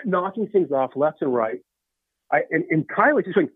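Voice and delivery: usual speaking voice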